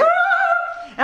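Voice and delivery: very high held "oh"; mock howling. Imitating neighbour bellowing on balcony, mock high howling. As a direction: mimic a man wailing his vocalises. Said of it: "il gueulait, il était".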